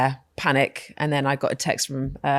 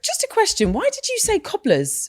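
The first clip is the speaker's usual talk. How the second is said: much higher curious questioning; quizzical question; 8 semitones higher than usual. Retold or imitated. Daughter quizzing her mother about bedtime, high curious. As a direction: ask mum like a puzzled kid. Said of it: "that I said to her".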